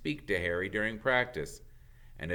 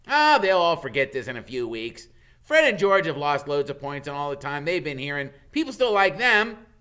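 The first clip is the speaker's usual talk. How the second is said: louder, higher eager reassurance; Ron, loyal friend; 5 semitones higher, 10 dB louder than usual. Acted. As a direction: cheer up your mate. Ron's voice comforting Harry.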